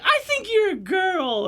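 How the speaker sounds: put-on high, slow little boy's voice, far above his own; little kid voice. Storyteller voicing small boy at hot springs, high child voice.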